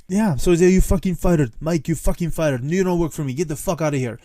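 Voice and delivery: a touch of the furious Greek owner; angry Greek accent. Retold or imitated. Owner throwing the cooks out, angry Greek accent. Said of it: "worst Greek impression of an old Greek guy".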